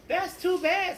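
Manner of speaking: a sly, mock-sorry teacher voice; high mock-sorry teacher.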